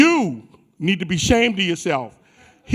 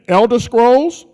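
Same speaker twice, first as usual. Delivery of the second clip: Elder Scrolls slow, rising and a bit louder; louder hype call; 3 semitones higher, 4 dB louder, slower than usual. Shown as a demonstration. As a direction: pump up the crowd on the second try. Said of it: "let me try this again".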